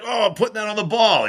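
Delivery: high, cheerful teammate voice; lifted, cheerful quoted voice; eager teammate. Retold or imitated. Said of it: "Harrington's like".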